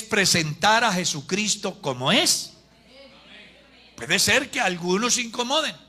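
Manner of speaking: loud, emphatic sermon climax; forceful preaching. Real, not acted. Preacher urging the church to live rightly for heaven, forceful preaching. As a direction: build to a ringing, full-force close.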